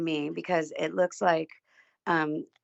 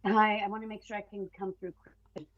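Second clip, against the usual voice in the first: on speakerphone; 4 semitones higher, 4 dB quieter, faster than usual. Real, not acted. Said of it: "Sounds like you're on speakerphone".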